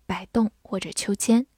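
normal talking voice

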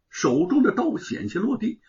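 plain reading voice

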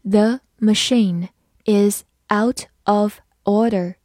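"The machine is out of order" slowly, one word at a time; slow reading. Shown as a demonstration. English teacher models "The machine is out of order", slow reading. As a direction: leave gaps between the words of "The machine is out of order". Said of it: "慢慢来读".

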